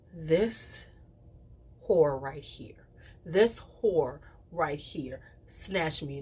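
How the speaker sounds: slow sly whisper. Real, not acted. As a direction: let it slip out as a slow whisper. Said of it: "slow whispered emphasis".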